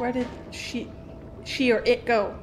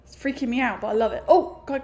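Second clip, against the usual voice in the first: normal talking voice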